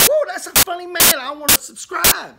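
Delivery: high, gushing mock viewer voice; hammed-up eager new fan; delighted fan. Minister mimicking viewers who love the funny man, high and gushing. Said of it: "people like that. They say".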